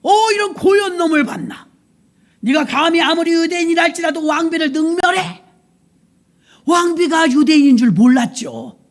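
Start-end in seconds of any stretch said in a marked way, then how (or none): 0.0-5.4 high, loud scolding rage; furious king berating a servant; angry king. Acted. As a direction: roar at the offender. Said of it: "임금님이 화가 났다 이거예요".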